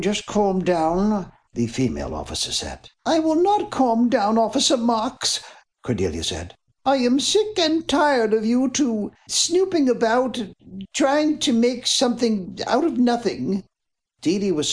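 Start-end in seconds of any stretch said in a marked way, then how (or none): 0.0-1.3 just calm down drawn out slow, calm stretched; slow it right down to soothe her; high officer voice stretching calm slowly; slow soothing repeat. Acted. 3.1-5.4 high voice with stress on not; young heiress pushing back, high and firm; defiant young woman. Acted. Woman defies police officer. 6.8-13.6 high voice with stress on not; young heiress pushing back, high and firm; defiant young woman. Acted. Woman scolds snooping officers.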